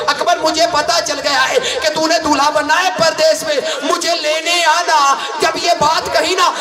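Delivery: high, impassioned lament recitation, near shouting; preaching at full force. Acted. Preacher to mourners at a majlis, impassioned wailing lament. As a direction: pour grief into the telling of the tale.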